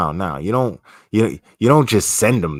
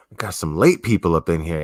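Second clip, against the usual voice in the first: usual speaking voice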